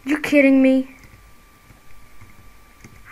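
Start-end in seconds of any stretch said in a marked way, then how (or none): 0.0-1.0 loud disbelieving outburst; blurting out in real annoyance; real exasperation. Real, not acted. Gamer to the game after dying again, a loud outburst.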